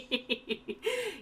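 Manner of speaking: bright burst of laughter; laughing. Real, not acted. GM laughs at foiled trap.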